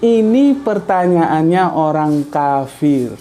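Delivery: each word laid out slowly; slow, emphatic restatement; slow deliberate repeat. Shown as a demonstration. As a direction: spell the sentence out word by word. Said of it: "Aku bilang apa?".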